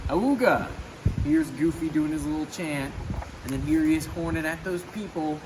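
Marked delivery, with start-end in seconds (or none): 0.1-0.7 higher mock horn honk; car horn awooga. A narrator's reading. Goofy blowing his horn for fun, mock honk. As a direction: honk like an old jalopy.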